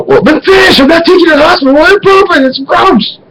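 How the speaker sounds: very high, frantic scolding; exasperated yelling. Retold or imitated. Son refuses dad a hospital trip, exasperated shrieking. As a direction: squeal it in hammed-up outrage.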